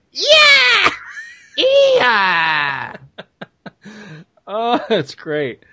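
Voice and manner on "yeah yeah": hammed-up comic death yell; mock falling scream. Voicing the other guy falling to his death, wailing mock scream. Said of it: "The other guy's going".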